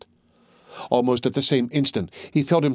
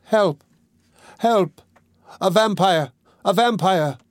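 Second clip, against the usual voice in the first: crying for help; 7 semitones higher, slower than usual. A narrator's reading. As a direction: scream it out as a last effort. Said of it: "began to cry".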